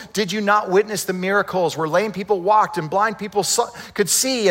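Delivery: high, urgent public appeal; imitated shouted preaching. Peter challenging thousands about Jesus, shouting. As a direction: fire insistent questions out at a crowd. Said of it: "He shouts".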